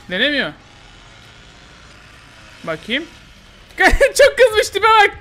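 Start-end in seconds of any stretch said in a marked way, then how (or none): 3.8-5.2 high, loud laughing talk; giggling high voice. Real, not acted. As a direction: laugh through the words at the angry killer. Laughs at the angry killer.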